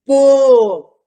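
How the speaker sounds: teacher calling out bo; loud bo. Shown as a demonstration. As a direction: shout the sound bo to the class. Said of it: "qual é o sonzinho".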